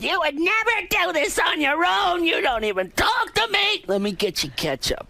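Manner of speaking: high, mocking scolding mom voice; imitating his nagging mother. Comedian imitating his mother nagging his father, mock scolding. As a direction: mimic a shrill-pitched nag, then drop. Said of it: "at the same time going".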